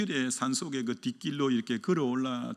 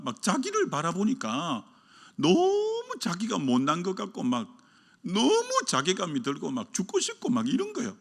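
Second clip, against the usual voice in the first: ordinary delivery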